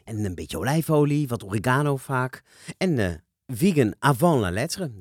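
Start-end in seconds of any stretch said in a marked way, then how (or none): none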